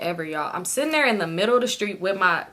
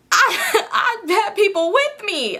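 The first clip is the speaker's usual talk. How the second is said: high, slow laughing realization; 11 semitones higher, much slower than usual. Real, not acted. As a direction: laugh it out in disbelief.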